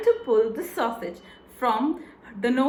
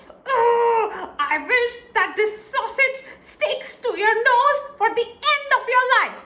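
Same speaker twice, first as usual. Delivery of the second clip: shrill, much higher and louder tirade; furious shrieking wife; 12 semitones higher, 5 dB louder than usual. Acted. Wife screaming at her foolish husband for wasting a wish, shrieking high and loud. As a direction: scold him at the top of your voice. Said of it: "His wife exclaimed".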